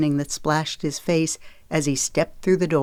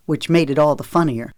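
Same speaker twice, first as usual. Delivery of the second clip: plain reading voice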